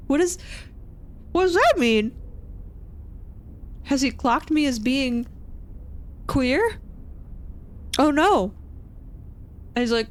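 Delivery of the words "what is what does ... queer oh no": high, alarmed inner voice; pitched-up flustered worry; mock panic. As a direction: wonder in rising comic dread. Acted inner alarm at remark.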